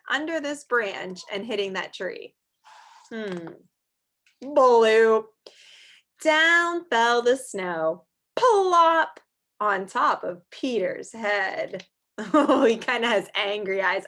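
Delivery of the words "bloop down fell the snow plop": loud playful bloop and plop; snow plop. Acted. Reading to children, loud playful plop. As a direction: drop the snow with a big plop.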